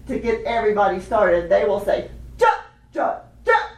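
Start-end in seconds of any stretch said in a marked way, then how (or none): 2.4-3.7 short, high rhythmic syllables; snare player's count-off. Shown as a demonstration. Teacher shows how snare players start everyone, short high calls. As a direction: call out the drum-line starter. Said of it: "what some of the snare drum players would say".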